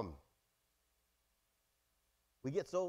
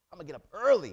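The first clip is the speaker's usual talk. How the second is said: blurted loud vow to get up early; loudly overpromise to God; sudden loud self-mockery; mock loud promise; 13 dB louder, faster than usual. Mocking own past promise.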